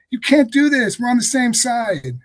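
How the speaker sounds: loud, high-pitched plea; agents' desperate protest. Acted. Agents pleading with their own side mid-raid, loud high protest. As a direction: cry out in protest to the raiders. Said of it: "some of them saying".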